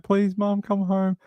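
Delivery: high, pleading cat voice; cats' voice. Retold or imitated. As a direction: beg sweetly for mom to return. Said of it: "The cats are like".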